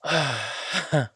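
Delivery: loud, open exhale; long audible sigh; heavy sigh. Acted. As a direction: let out a big sigh before speaking. Hesitant before breaking news to partner.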